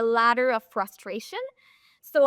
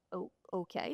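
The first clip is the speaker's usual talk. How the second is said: a soft, deflated oh okay; taken aback, softer; 5 semitones lower, 13 dB quieter than usual. Retold or imitated. Coach caught off guard by the golfer, deflated and soft. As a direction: caught off guard, voice drops away. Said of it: "And I'm like".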